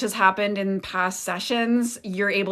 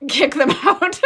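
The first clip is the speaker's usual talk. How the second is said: louder, slowed words broken by a laugh; 6 semitones higher, 10 dB louder than usual. Real, not acted. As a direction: laugh as you give the instruction. Laughs while instructing new moderators.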